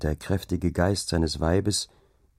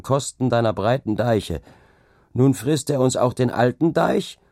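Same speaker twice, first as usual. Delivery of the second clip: higher, louder sneering rival; scornful Ole; 5 semitones higher, 4 dB louder than usual. A narrator's reading. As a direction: lay into the dike-reeve with contempt. Ole mocks the dike-reeve.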